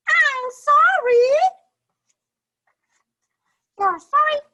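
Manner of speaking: very high, loud sorry and mad character voices; upset storybook voices. Acted. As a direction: ham up the sad, cross quarrel for kids. Characters argue over broken toy.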